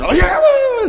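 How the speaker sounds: high, whooping cheer. Retold or imitated. Players cheering their coach, whooping. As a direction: whoop it up like the team. Boys cheering after the speech.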